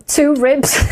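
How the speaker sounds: bursting into laughter; laughing at the punchline. Real, not acted. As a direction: crack up at the end. Retelling friend's injury, laughing.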